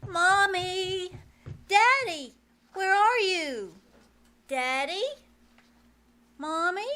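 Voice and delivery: loud, anxious calls through the house; calling out. Acted. Girl calling for her missing parents, loud and anxious. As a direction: shout for the parents room to room.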